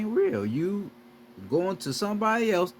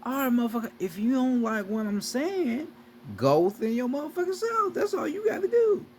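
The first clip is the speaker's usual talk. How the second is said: mock retort; 6 semitones higher than usual. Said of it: "I'm like".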